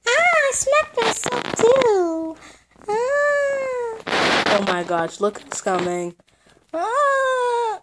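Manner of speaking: very high, wailing doll voice; whiny doll. Acted. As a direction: cry out like a hurt toy. Voices hurt doll character.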